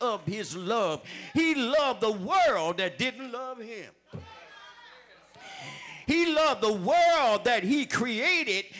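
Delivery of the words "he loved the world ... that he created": full-force preaching. Acted. Preaching climax.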